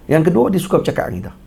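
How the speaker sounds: ordinary talk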